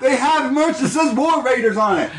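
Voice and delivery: high laughing disbelief. Real, not acted. Podcaster laughing at merch for a team that's leaving, high and amused.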